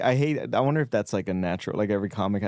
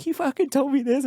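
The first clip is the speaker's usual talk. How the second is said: very high-pitched mock complaint; whiny complaint imitation. Daughter complaining to her mom on the phone, whiny.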